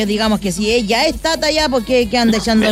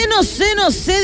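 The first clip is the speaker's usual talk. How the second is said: higher, sing-song no sé, no sé quoting a guy; quoting someone; 8 semitones higher than usual. Retold or imitated. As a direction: toss out no sé, no sé in a borrowed voice. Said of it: "dijo el otro".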